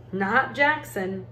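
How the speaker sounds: usual speaking voice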